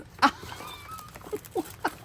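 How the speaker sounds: high airy overwhelmed laugh; soft giggle of delight; breathy laugh. Real, not acted. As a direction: laugh out of sheer disbelief. Overwhelmed by gift.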